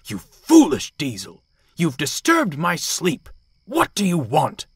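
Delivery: loud, indignant telling-off; scowling. A narrator's reading. Dudley berates Bear for waking him, scowling angrily. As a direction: scold whoever woke you, high and loud. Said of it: "Dudley scowled".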